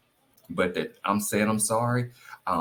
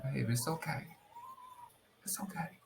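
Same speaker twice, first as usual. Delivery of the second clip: very soft, higher-pitched comforting; tender reassurance; 12 dB quieter than usual. Retold or imitated. Wife forgiving husband who admits he was wrong, soft reassurance. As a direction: hush it gently, almost fading away.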